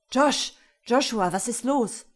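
put-on loud, urgent cry to the boy; alarmed call. Father bursting in to find his son screaming, loud and alarmed.

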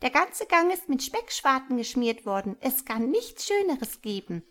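very high squeaky mouse. A narrator's reading. The mouse pulling Hjalmar, in a very high squeak.

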